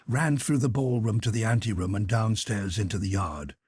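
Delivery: ordinary delivery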